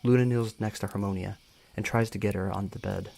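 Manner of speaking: ordinary delivery, nothing marked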